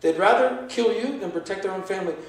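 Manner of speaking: normal talking voice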